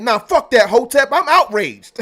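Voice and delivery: loud, high-pitched indignant outburst; mock outrage. Streamer quoting his friend Shaw's outburst, mock outrage.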